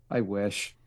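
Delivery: low deadpan sigh; dry, resigned aside; deadpan low mutter. Pretend. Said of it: "You're like".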